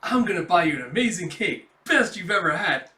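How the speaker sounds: loud, eager acted boast; excited promise. Character promising an amazing cake, excited and loud. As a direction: announce the cake with big enthusiasm.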